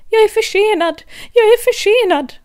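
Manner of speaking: high, loud panicked cry; a very high loud rushed rabbit shout; frantic calling. Acted. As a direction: cry out like a rabbit who is late.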